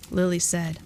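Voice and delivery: ordinary delivery, nothing marked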